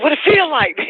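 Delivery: high, excited what it feel like; eager question. Retold or imitated. Mother asks daughter about the car, high and eager. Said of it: "I said".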